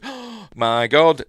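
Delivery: gasp, then loud high slow German-free horror; mock shock. Heroine discovers the villain's secret, gasping mock shock. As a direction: play a hammed-up shocked discovery. Heroine finds book, gasps.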